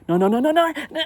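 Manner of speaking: high, loud volley of no's; retold panic, rising to a yelp; mock panic. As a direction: blurt it in comic alarm. Mock panic as legs give way.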